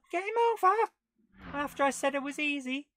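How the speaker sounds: gasp, then a very high, loud, fast cry; shocked squeal at losing; high loud dismay. Real, not acted. Losing the race suddenly.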